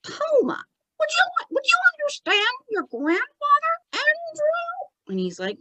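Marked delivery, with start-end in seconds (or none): 0.0-0.6 Toma repeated in a very high puppet voice; high spirit echo. Acted. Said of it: "And he says". 1.0-5.1 very high, halting ventriloquist question; Tommy. Acted. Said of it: "And then he says".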